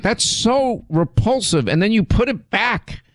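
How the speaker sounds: high, slow, drawn-out mock disgust. Host recalling bitten cheese to her husband, mock disgusted. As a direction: stretch it out, mock revolted. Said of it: "I'm like".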